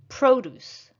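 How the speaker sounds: Produce with a high, long PRO; stress on first syllable. Shown as a demonstration. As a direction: punch the start of Produce, as a noun. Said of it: "practice shifting the stress".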